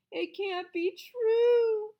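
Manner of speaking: put-on high, loud, slow child's wail. A narrator's reading. Girl wailing over her teacher's departure, slow and loud. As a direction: draw the wail out in dismay. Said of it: "wailed Emberly".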